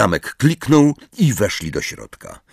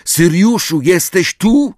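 loud, high searching call; 11 semitones higher, 5 dB louder, slower than usual. A narrator's reading. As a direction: call into an empty house for someone. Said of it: "zawołał". (głośne, wysokie wołanie)

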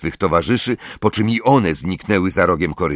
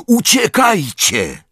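high, drawn-out call to flee; roar; 9 semitones higher, 3 dB louder, slower than usual. A narrator's reading. Harry orders friends to flee, roaring. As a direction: cry out the order, long and high. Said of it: "ryknął".

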